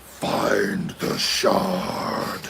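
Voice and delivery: low, slow whispered cave voice; grinding stone statue. Retold or imitated. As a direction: breathe it out like a stone giant. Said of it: "I do the voice well".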